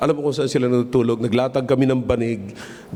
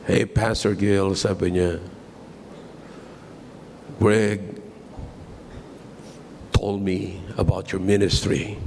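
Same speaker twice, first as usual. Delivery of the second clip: lower, slow, softer voice for the friend's father; lightly voiced older American man, slowed and kind; a hint of an American father; 5 semitones lower, 4 dB quieter, slower than usual. Pastor retelling the father's generous offer, lower and slow. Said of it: "Sabi nung daddy niya".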